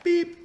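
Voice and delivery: high-pitched electronic beep noise; imitated beep. Showing viewers how to check the app, imitated beep. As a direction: make a quick high beep with your voice.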